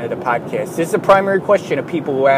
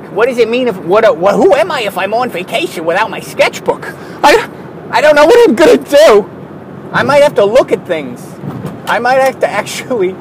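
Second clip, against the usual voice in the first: higher, louder, frantic mock worry; mock panic; 6 semitones higher, 5 dB louder than usual. Artist imagining vacation without sketchbook, mock panic.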